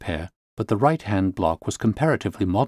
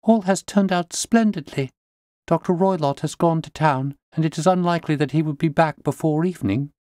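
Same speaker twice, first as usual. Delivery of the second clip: higher voice full of relief; relieved young lady; 8 semitones higher than usual. A narrator's reading. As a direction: tell them the danger is gone, glad. Relieved client reports good news.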